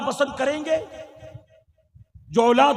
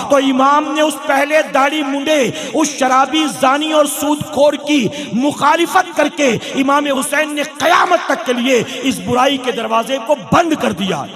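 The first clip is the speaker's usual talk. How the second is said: fiery sermon; 7 dB louder than usual. Acted. Preacher rousing the congregation at Friday gathering, fiery loud sermon voice. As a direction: thunder it at the congregation and keep building.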